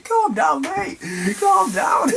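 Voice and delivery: very high squeal through laughter; bursting out laughing. Retold or imitated. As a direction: plead in a helpless giggly squeak. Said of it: "bursting out laughing".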